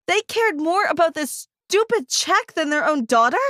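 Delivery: ordinary delivery, nothing marked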